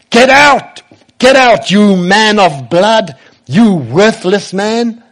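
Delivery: high, loud shout hurling abuse; angry shout. Acted. Cursing man shouts at the fleeing king, furious high shout. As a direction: shout it out as an accusation, drawing out the insults. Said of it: "he shouted".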